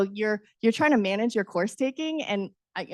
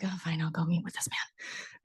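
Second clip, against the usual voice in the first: softer whispered aside; whispered grumble; 3 semitones lower, 9 dB quieter than usual. Retold or imitated. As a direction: grumble it under your breath. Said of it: "I was just like".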